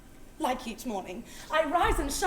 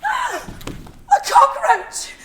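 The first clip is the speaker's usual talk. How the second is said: gasp, then a drawn-out loud, high cry; horrified shriek; 14 semitones higher, 11 dB louder, much slower than usual. Acted. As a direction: shriek at the sight of a bug. Discovering the cockroach.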